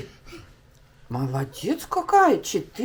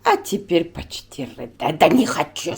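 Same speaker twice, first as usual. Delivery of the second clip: ordinary delivery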